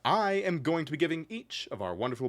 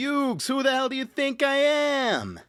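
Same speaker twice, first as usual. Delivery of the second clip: high, loud Brooklyn tough-guy yell; Joey Wheeler impression; 5 dB louder than usual. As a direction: shout it like a cocky street punk. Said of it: "give me your best Joey Wheeler".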